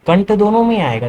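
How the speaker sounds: usual speaking voice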